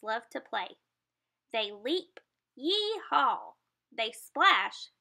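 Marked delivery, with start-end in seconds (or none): 2.5-3.5 higher, bouncy yee-haw; playful yee-haw. Acted. Reading to kids, dolphin leaping with a yee-haw. Dolphins leap, sound effect.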